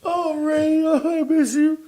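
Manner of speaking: high-pitched wail of hammed-up grief; mock bawling. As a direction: imitate yourself sobbing over a friend. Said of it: "I'm crying, bawling my eyes out".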